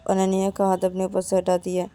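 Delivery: ordinary delivery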